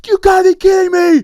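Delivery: huge high yell of disbelief; mock outraged yell; outraged shout. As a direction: scream it like a furious star. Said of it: "And then he goes".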